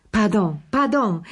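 high, quick exclamation; lightly squawked apology; mild screech. A narrator's reading. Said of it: "kreischte Madame Pelou".

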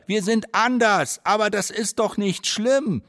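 high, slow pleading call. Acted. Community calling to the city, slow high earnest shout. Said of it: "rufen wir".

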